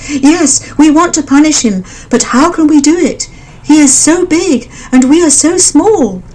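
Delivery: fretting about the big beast; worried birds. A narrator's reading.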